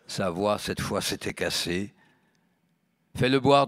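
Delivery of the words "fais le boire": loud demand. Acted. Marie demands.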